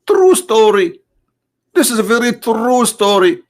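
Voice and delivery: high-pitched sarcastic assurance; mock insistence. Comedian vouching for an absurd tale, mock insistence. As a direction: vouch for it up high, tongue in cheek.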